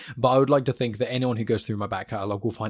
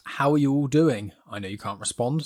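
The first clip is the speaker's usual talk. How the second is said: usual speaking voice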